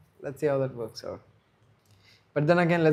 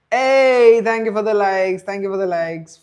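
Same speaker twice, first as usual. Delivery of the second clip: much louder and higher, bursting with thanks; excited thanks; 7 semitones higher, 11 dB louder than usual. Real, not acted. Streamer thanking viewers for the likes, excited loud shout. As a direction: shout your thanks to the chat.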